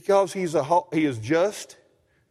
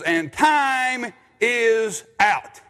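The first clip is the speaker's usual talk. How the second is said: very slow, drawn-out announcement; other announcer's call; 4 semitones higher, much slower than usual. Retold or imitated. Pastor mimics the rival announcer, very slow and drawn out. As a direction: stretch every word like a gym announcer. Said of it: "This guy says".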